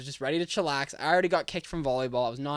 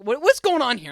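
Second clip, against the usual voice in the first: incredulous outburst; 8 semitones higher, 8 dB louder than usual. Retold or imitated. Stunned by stranger preaching.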